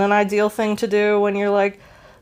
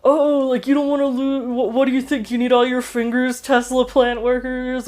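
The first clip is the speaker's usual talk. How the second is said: higher-pitched tech billionaire voice; lightly mocking rich boss, higher; Elon Musk impression; 5 semitones higher than usual. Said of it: "like, Elon Musk now".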